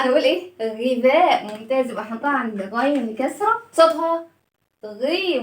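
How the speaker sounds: normal talking voice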